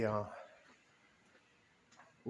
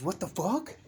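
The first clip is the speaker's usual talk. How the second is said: higher-pitched mock outrage; higher, indignant question in a retelling; mock indignation; 6 semitones higher than usual. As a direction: imitate an affronted stranger, rising into a question. Retelling a woman's indignant reaction.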